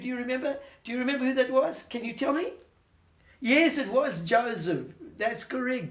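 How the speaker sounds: ordinary talk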